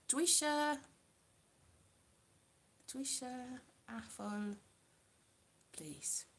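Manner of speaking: "dwi isio apple, please" slow and fading to near silence; hesitant learner Welsh, trailing off softly; halting Welsh. Shown as a demonstration. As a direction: mumble "dwi isio apple" like a shy beginner. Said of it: "this is what I'm like".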